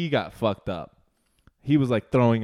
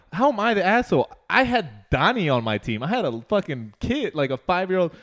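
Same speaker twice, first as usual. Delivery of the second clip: indignant protest; 7 semitones higher, 3 dB louder than usual. Retold or imitated. Defends himself against accusing relatives.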